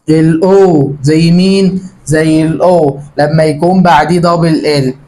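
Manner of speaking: usual speaking voice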